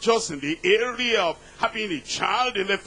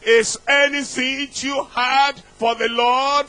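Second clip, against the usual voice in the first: raised, commanding preacher's God; louder, higher divine command; Almighty God; 5 semitones higher, 5 dB louder than usual. Acted. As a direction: proclaim it loudly as God. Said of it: "Almighty God said".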